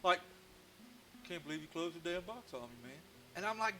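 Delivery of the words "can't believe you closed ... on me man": a lightly voiced grumpy carving, softer and put-upon; grumpy whittle voice. Carved hobo grumbling at the carver, lightly voiced grumpy complaint. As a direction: sulk like a wooden figure shut in a box.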